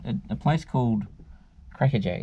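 ordinary delivery

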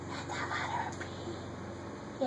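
soft breathy whisper. Acted. Reading the whisper page to sister, whispering. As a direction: whisper it like a secret. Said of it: "Mister Brown can whisper".

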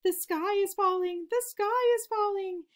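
high, loud panicked cry of a frightened chick; panicked scream. A narrator's reading. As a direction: shriek it like the sky is really falling. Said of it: "Turkey Lurkey screamed".

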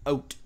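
"oat" for out, with an oh vowel; low, soft demo of "oat"; own Canadian out. Shown as a demonstration. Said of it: "I say".

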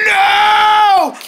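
high loud shouted no; retold scared no. King reenacting his scared shout of no. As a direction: reenact the scream. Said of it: "I just had to go like".

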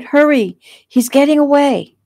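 high, loud, urgent alarm; crying out. A narrator's reading. Victim sending the officer after her attacker, crying out. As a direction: shout to hurry the rescuer on. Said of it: "she cried".

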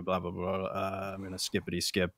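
normal talking voice

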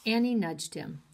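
ordinary delivery

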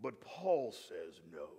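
very deep, slow British-American narrator; British American accent. Retold or imitated. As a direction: intone it low, soft and drawn out in a British-American accent. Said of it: "the guy in the British American accent".

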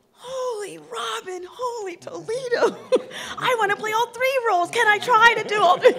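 very high, squealing, laughing delight; gushing excitement. Retold or imitated. Reading the play, wanting all three roles, squealing with laughter. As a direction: squeal like a giddy fan begging for every role. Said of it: "I was like".

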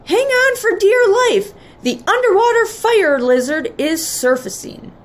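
high, excited warning call; high-pitched dramatic alarm; urgent shout. A narrator's reading. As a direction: warn the passengers with storybook urgency. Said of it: "Patrick shouted".

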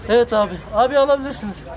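ordinary delivery

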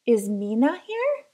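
"Mina" with an N instead of R-H; teacher calling roll, saying "Mina"; teacher mispronouncing. Acted. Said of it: "teachers said it wrong".

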